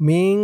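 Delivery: মিন ending in a held nasal hum; long nasalised close on মিন; ikhfa ghunnah. Shown as a demonstration. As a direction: hum the n of মিন through the nose. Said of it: "ইকফা গুন্নাহ".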